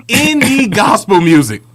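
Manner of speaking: laughing, drawn-out emphasis on any. Shown as a demonstration. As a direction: stretch it out through a laugh. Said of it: "repeats the phrase".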